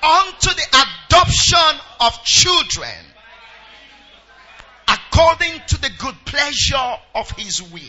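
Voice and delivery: reading the verses out loud in an unhurried preaching cadence; proclaiming scripture over the music. Acted. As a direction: lead the church through the verses, full voice. Leads loud scripture reading again.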